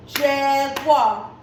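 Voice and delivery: "jaguar" slow in two beats, jag-uar; measured, separated halves of "jaguar"; clapped-out syllables. Shown as a demonstration. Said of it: "How many syllables do you hear".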